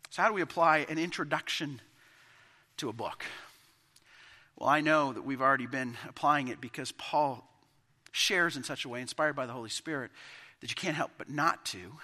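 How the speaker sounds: usual speaking voice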